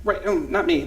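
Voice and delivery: usual speaking voice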